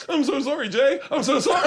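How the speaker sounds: high-pitched pleading through laughter; hammed-up remorse, pitched high; mock distraught apology. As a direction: imitate a sweet guy apologizing desperately. Acts Shane's upset apology, laughing.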